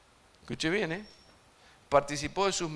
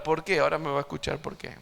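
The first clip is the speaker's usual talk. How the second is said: ordinary delivery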